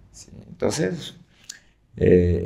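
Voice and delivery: usual speaking voice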